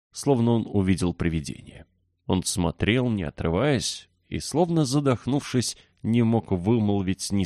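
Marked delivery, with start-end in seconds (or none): none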